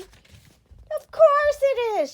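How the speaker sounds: very high, loud, slow giggle through the words; giggling. A narrator's reading. Miss Giggles finally giggling again, bursting with laughter. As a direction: let the giggle burst out at last. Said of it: "she giggled".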